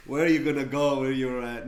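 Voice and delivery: loud, laughing imitation; laughing mock quote. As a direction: boom it out through laughter. Mock-quoting friend's advice, laughing.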